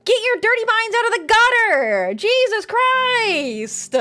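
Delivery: loud, high-pitched outburst, last words drawn out; exasperated scolding. Real, not acted. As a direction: scold the chat at full volume. Scolding chat for dirty jokes.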